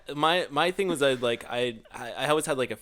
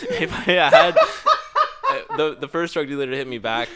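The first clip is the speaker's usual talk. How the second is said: laughing; 5 dB louder, slower than usual. Real, not acted. Cracking up mid-story.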